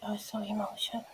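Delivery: hushed fast repeat; whispered. Real, not acted. Whispering to viewers about how emotional she felt. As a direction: breathe it out, overcome.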